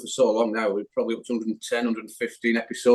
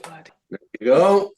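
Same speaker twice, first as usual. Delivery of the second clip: slow drawn out; 4 semitones higher, 6 dB louder, much slower than usual. Acted. Mock admiring the gardening tip.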